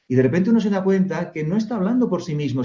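ordinary delivery

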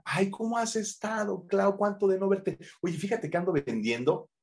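high gushing friendly chatter; gushing party greeting. Retold or imitated. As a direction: greet an old friend sweetly, then pitch. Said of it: "Uno no va a una fiesta a".

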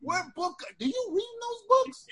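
mock amazed American dude; mock impressed American bro. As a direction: ham up a wowed American guy. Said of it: "and then they'd be like".

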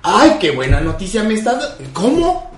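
high, squealing ¿Cómo? repeated; mock delighted surprise. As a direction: act thrilled and disbelieving at good news. Imitates Pepillo's delighted surprise. (sorpresa fingida, muy aguda)

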